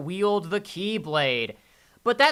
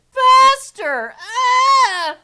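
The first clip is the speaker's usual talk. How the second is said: much higher, louder shriek of faster; hammed-up shriek; 10 dB louder, much slower than usual. Riding along, shrieking at the ride to go faster. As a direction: scream it like the thrill peaks.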